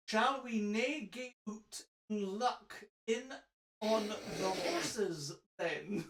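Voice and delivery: slow, measured mock-RP on Scots words; over-careful RP English, spaced out and soft; RP accent. Shown as a demonstration. Reader showing the English version for contrast, slow RP accent. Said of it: "in an R P dialect".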